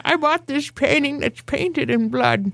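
slow, high mock grandma voice; old lady. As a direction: play a dotty old lady, half laughing. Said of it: "An old lady".

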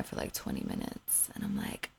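hushed aside, no voice at all; breathy whispered aside. Real, not acted. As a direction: drop to a whisper mid-story. Waiting ages in line.

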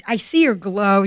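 ordinary delivery

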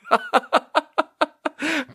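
high, rapid cackle; helpless real giggling; hearty laughter. Real, not acted. Remembering the coworker almost felt bad, high laughter.

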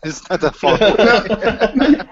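laughing. Shown as a demonstration. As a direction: stretch it out while laughing.

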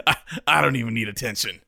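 lightly voiced celebrity impression; quick, stammered mock actor voice; Sean Penn. Co-host joins the joke about an attention-shy actor, light impression. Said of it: "Try to do my Sean Penn impression".

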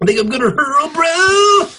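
high, strained about-to-hurl voice; hammed-up nausea; queasy stoner. Playing sick stoner.

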